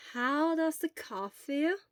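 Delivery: put-on slow, gentle questions from an old nurse; lightly voiced elderly nurse. Elderly school nurse gently questions a feverish boy. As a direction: ask slowly and calmly, like a school nurse.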